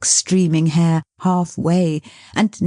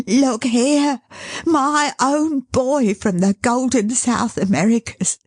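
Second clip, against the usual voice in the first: exultant; 8 semitones higher than usual. Acted. Caleb showing his son to the carrier, exultant. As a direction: crow with joy. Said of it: "said Caleb exultingly".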